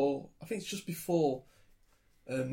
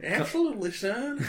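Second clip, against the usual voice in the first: put-on higher, jokey Australian American accent on absolutely; mock Australian American; 10 semitones higher than usual. Teasing a co-host's verdict, higher mock Australian American. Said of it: "I'm Australian American".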